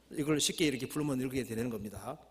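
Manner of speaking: usual speaking voice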